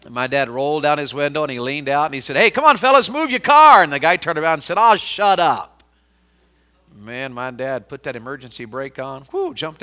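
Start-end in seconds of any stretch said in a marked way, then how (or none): 2.4-3.8 loud, higher call out the truck window. Retold or imitated. Dad leaning out the window to the men blocking the road, loud call. 4.8-5.8 rude brush-off. Retold or imitated. Hippie answers dad rudely.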